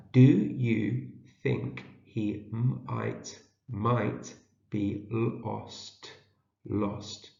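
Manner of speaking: very slow, gaps between words and sounds; sounding out. Shown as a demonstration. Teacher decodes a question for early readers, sounding out. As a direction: stretch and split the words, then blend. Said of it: "see if we can read it".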